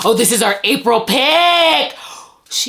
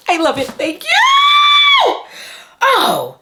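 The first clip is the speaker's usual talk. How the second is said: piercingly high, drawn-out squeal; squealed thanks; slower than usual. Real, not acted. Vlogger thanking the book binder, squealing. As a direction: let the thanks shoot up into a shriek.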